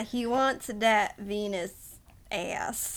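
very slow mock Texan drawl. Joking about the oil baron, slow Texan drawl. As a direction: stretch it out like a Texan.

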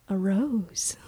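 hushed whisper of wonder. Retold or imitated. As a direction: breathe it as a secret. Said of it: "So he's like".

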